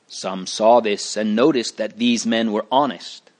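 ordinary delivery

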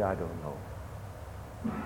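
quiet shrug. Retold or imitated. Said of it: "you begin to say".

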